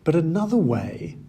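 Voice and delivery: normal talking voice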